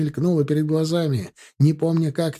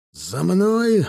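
raised, rallying command; barking; 5 semitones higher than usual. A narrator's reading. Captain leading infantry into attack, rallying bark. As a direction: summon the men to follow. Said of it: "рявкнул выскочивший из подъёма".

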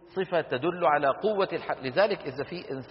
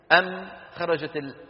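usual speaking voice

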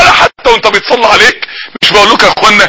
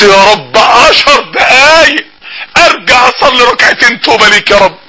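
slow, crying cry out, stretching عشر دقايق; tearful plea; slower than usual. Retold or imitated. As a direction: beg God for ten more minutes, weeping. Dead man begging ten minutes. (رجاء باكي ببطء)

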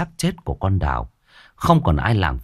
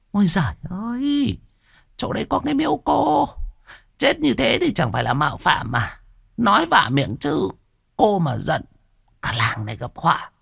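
put-on high-pitched nagging old shopkeeper; old woman voice; 12 semitones higher than usual. Old shop owner nagging the debtor, high old woman voice. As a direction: needle him about his debt like a sharp old woman.

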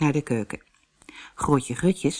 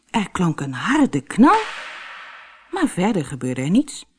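ordinary delivery, nothing marked

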